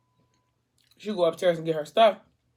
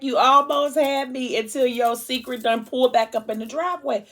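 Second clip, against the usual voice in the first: mock him sharply, pitched up; sarcastic mockery; 7 semitones higher than usual. Girlfriend mocking, caught him out.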